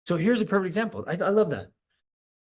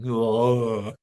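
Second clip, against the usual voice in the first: low deflated ugh; weary groan; 6 semitones lower, 4 dB louder than usual. Retold or imitated. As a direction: groan like your heart just sank. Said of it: "you're like".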